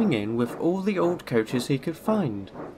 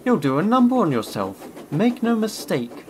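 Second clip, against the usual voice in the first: scolding; 6 semitones higher, 4 dB louder than usual. A narrator's reading. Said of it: "scolded the driver".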